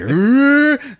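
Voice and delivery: high, loud straining roar; hammed-up warrior grunt; battle growl. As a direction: growl as if heaving up a sword. Imitates sword swinger's roar.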